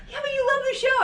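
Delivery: high, loud, laughing tease; teasing daughter. Retold or imitated. Daughter teasing her mother, high and laughing. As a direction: needle mom with a laugh. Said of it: "She goes".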